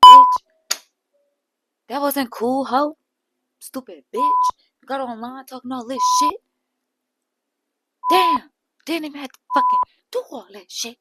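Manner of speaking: imitated cussing out. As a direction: tear into her with curses. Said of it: "She just cussing at her so hard".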